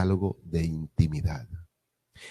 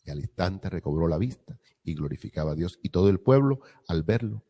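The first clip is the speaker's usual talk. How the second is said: ordinary talk, nothing marked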